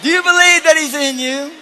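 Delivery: high, shouted rallying questions; full-force preaching. Real, not acted. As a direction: fire each question loudly at the congregation. Preacher rallies the congregation.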